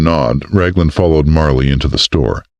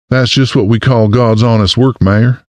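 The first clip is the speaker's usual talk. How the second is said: put-on higher, bright Southern accent; 7 semitones higher than usual. A narrator's reading. Florist greeting the mayor in a Southern accent. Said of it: "responded in an accent so southern".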